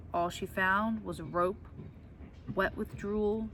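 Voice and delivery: ordinary delivery, nothing marked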